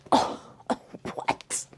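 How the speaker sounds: breathless whispered disbelief; stunned whisper. Retold or imitated. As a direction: whisper it as if the wind was knocked out of you. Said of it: "and you're just like".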